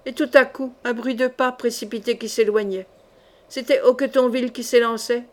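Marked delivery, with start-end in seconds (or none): none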